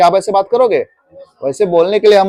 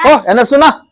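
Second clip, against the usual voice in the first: mock offhand hail, pitched higher; calling a stranger; 5 semitones higher than usual. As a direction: demonstrate a blunt call to a stranger. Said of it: "उसको पुकारना है".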